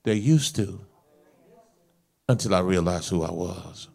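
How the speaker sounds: low, soft earnest delivery; subdued, measured preacher; quiet pastoral counsel. Real, not acted. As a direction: drop right down and counsel them gently. Drops to quiet counsel after shouting.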